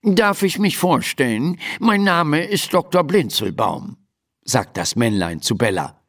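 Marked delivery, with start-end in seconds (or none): 0.0-4.0 high-pitched, courteous gnome doctor; formal little doctor. Acted. Little doctor presents himself to the horse, formal. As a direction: introduce yourself formally in a raised character voice.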